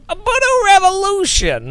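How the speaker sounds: mock slogan read; imitating Sean Cole. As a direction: sell the name like an advert. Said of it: "See, Sean Cole".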